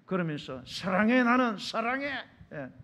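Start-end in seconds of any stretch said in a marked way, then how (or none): 0.7-2.1 shouted, threatening-sounding declaration of love. Acted. Preacher mimics someone who shouts their love like a threat, shouted. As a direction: bark out love like a demand. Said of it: "막 소리를 지르면 사랑한대요".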